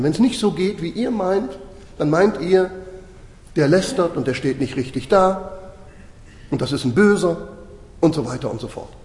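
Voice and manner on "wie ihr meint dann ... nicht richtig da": normal talking voice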